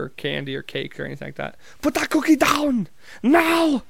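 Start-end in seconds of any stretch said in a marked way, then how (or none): 1.8-3.8 high, drawn-out mock yell; slow, high-pitched shouting impression; Arnold yelling. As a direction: imitate a movie-star yell without full volume. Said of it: "have Arnold just yelling".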